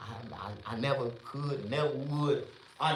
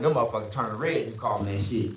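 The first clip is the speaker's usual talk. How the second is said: normal talking voice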